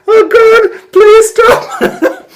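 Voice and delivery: high, loud, laughing "please stop". Retold or imitated. The narrator's inner plea at the meeting, laughing hard. Stifling laughter at earnest man.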